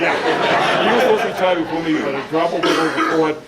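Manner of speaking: loud, fast, laughing teasing; real laughter teasing a retiring colleague; laughing tease. Real, not acted. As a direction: rib him through laughter.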